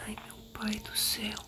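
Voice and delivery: unvoiced hushed whisper. Real, not acted. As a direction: breathe the words, hiding. Hiding from the granny.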